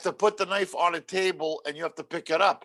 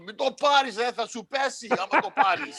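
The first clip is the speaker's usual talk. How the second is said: higher mock warning from an old uncle; imitating uncle; 7 semitones higher than usual. Uncle warns against the vaccine, higher mock alarm. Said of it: "I hear my uncle".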